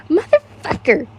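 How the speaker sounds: mild mock shriek. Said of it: "you shrieked".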